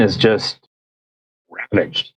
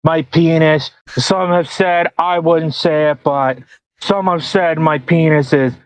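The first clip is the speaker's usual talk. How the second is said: higher, drawn-out presidential parody; Trump; 4 semitones higher than usual. Pretend. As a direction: boast slowly in a mock politician's voice. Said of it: "You do a Trump voice".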